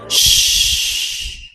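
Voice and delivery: usual speaking voice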